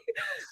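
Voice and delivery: short high giggle; high laugh. Real, not acted. Guest laughing at her own story, high giggle.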